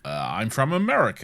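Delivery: proud, dumb fake American voice; mock American accent. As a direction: answer like a caricature American. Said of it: "Doctor Strange does his American accent".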